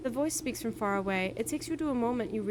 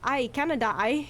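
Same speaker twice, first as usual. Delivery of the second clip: loud, raised mock Canadian accent; 3 semitones higher, 7 dB louder than usual. Shown as a demonstration. Player guessing at the accent they slipped into, mock Canadian accent. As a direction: put on a jokey Canadian accent. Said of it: "it's more like Canadian".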